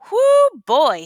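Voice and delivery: worn-out cowboy whooping at the saloon; weary whoop. A narrator's reading. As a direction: let out a big tired whoo. Tired cowboy reaching a town.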